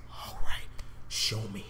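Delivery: breathy hushed whisper, barely voiced. Real, not acted. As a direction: whisper it in tense anticipation. Fluisterend afwachten op trailer.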